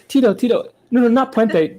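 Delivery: high, loud pop star impression. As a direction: call out in a high put-on voice. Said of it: "He goes".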